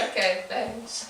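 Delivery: slow, soft thanks; reply slowly and very quietly; muted retold politeness; underwhelmed. Retold meek reply to peppy girls.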